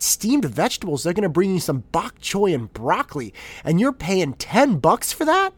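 scoffing high and loud at a pricey order; disdainful. Retold or imitated. Man at dinner mocking a steamed vegetables order, disdainful and loud. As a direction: sneer at the waste of money, rising in outrage. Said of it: "thinking disdainfully".

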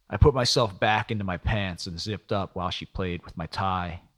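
ordinary delivery

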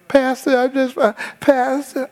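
high, whining mock voice; whiny man. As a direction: ham up a helpless man pleading. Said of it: "And the guy".

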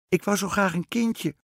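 high, loud, pleading queen; queen calling up. A narrator's reading. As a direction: call your wish up into the treetop. Queen calls up to witch in tree.

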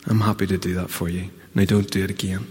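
tender forgiving voice with a gentle warning; gentle reassuring. Retold or imitated. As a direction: comfort the weeping one softly. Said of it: "he smiles and says".

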